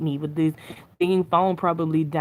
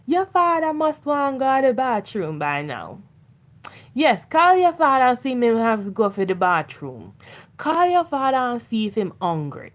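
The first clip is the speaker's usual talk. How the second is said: lilting Caribbean accent, higher, voicing mom; imitating mom's Caribbean accent; 5 semitones higher than usual. Mom on the drive, nudging her to call father, Caribbean accent. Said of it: "she'll be like".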